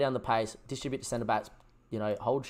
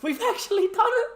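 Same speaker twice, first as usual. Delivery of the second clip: screamed much higher and louder, pure joy; ecstatic screaming; 3 dB louder than usual. Real, not acted. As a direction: scream the victory at the top of your lungs. Still celebrating the win.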